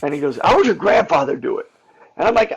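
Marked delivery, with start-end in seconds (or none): 0.4-1.7 louder, higher, pushing him on it; demanding an answer in a raised voice; pressing question. Retold or imitated. Director pushing the actor, louder and higher.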